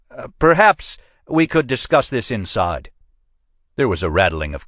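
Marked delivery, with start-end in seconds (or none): 0.1-2.9 higher, hurried backing-down captain; suddenly eager to placate; captain's voice. A narrator's reading. Captain caving to Pendergast, higher and placating.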